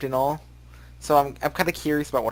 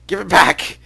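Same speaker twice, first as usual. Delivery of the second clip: demanding shout; 6 semitones higher, 9 dB louder, slower than usual. Acted. Demanding clothes back.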